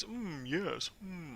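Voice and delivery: high, soft smug British murmur; British highbrow. Acted. Player imitating Snake's smug face, British highbrow accent. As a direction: hum and agree like a British snob. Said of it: "I can't do the accent very well".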